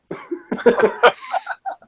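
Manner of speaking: a high, breathy burst of real laughter. Real, not acted. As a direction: laugh out loud at the obvious twist. Laughing at obvious plot twist.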